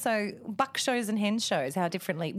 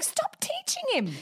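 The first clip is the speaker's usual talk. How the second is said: much higher and slow, whiny complaint; mock exasperated plea. Mum complaining to partner about teaching the kid. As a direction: draw it out high like a fed-up mum.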